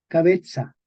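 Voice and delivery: cabeza said as ca-BE-tsa; medieval pronunciation. Shown as a demonstration. Teacher giving an example, medieval ts pronunciation. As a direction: give cabeza a ts for the z. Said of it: "Se pronuncia ts".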